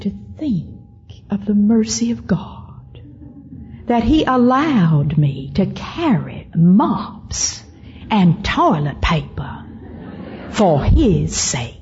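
slow, hushed start opening into a high Southern drawl; Southern accent. Retold or imitated. Old house mother marvelling at God's mercy, imitated reverent Southern accent. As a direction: imitate an older Southern lady marvelling, every word stretched. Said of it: "with that Southern accent".

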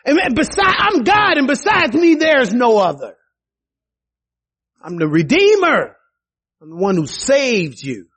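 high, ringing divine proclamation; God declaring his greatness. Acted. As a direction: proclaim as God, rising grandly. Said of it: "He said".

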